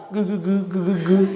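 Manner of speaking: stammering on one repeated syllable; imitated stutter. Teacher showing the great orator was a stutterer, stammering. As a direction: mimic a stutterer stuck on "gu". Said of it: "Só que ele era gago".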